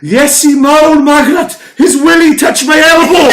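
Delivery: put-on high, loud, slow, laughing old lady; indignant grandma. Grandma complains to her daughter, high and scandalised. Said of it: "She went".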